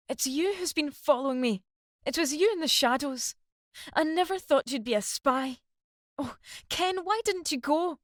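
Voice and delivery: high, desperate screech of betrayal; high screeching accusation. A narrator's reading. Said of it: "she was screeching into the darkness".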